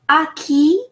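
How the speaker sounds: À qui with a plain open "ah"; French model reading. Shown as a demonstration. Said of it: "is just simple like that".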